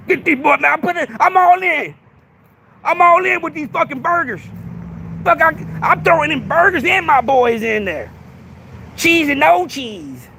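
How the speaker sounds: mock Big Ox voice; imitating Big Ox. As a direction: play the cocky card player shoving in his chips. Imagines Big Ox gambling burgers.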